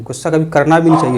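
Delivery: normal talking voice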